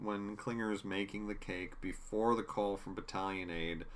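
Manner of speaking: ordinary talk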